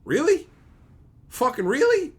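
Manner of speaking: high rising exasperated question; incredulous. Real, not acted. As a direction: demand it in sheer disbelief. Disbelief at a plot hole.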